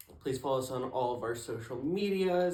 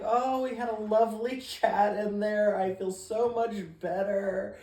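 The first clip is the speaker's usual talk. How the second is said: mock cheerful high voice; imitating a chirpy girl, pitched up; airy, cheerful, higher voice; high sweet girl voice; 6 semitones higher than usual. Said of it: "she's like".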